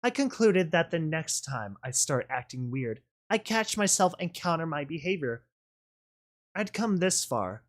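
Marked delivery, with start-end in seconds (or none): none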